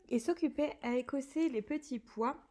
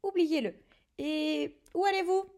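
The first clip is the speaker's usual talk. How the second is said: alarmed call; 4 semitones higher, 6 dB louder than usual. A narrator's reading. Hagrid calling after fleeing kids.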